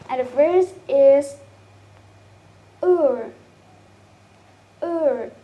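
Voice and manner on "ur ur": "ur" as one gliding, falling sound; diphthong demo. Shown as a demonstration. Teacher showing classmates the vowel in poor, drawn-out diphthong demo. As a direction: model "ur" slowly and clearly, pitch dropping. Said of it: "some vowels as examples of diphthongs".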